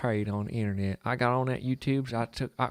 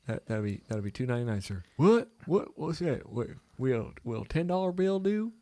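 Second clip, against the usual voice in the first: mock Dayton, Ohio accent, confused and pitched up; a baffled Dayton, Ohio customer asking "What?"; 4 semitones higher than usual. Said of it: "I'll do the Dayton accent".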